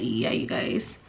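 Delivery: whisper. Real, not acted. Sudden unvoiced aside to viewers.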